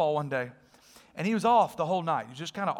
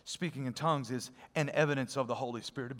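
ordinary talk

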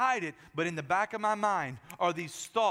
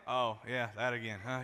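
mock-weary wife's voice; bored wives; 6 semitones lower than usual. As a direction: roll your eyes at the game again. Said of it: "they're like".

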